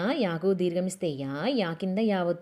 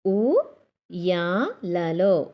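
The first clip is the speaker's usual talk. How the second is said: very slow spelling-out read; 4 dB louder, much slower than usual. Shown as a demonstration. Teacher sounding out word slowly.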